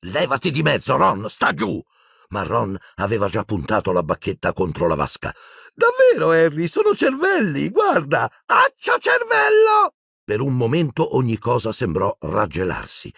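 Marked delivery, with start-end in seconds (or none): 0.0-1.8 higher-pitched sharp warning; urgent order. A narrator's reading. Harry tells Ron to get away, urgent. 5.7-8.3 very high-pitched silly enthusiasm; giddy excitement. A narrator's reading. Dazed Ron insists Harry look at brains, giddy. 8.5-10.0 very high, drawn-out silly cry; giddy shouted spell. A narrator's reading. Dazed Ron summons a brain, shouting giddily.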